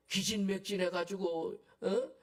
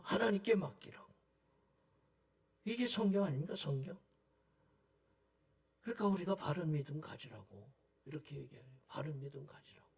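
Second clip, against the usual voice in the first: ordinary talk, nothing marked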